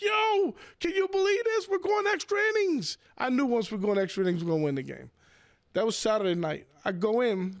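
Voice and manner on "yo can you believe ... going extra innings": excited text quote. Retold or imitated. The counter kid texting about extra innings, high excited yelp. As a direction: sell the kid's giddy excitement. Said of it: "He's like".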